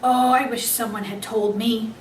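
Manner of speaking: usual speaking voice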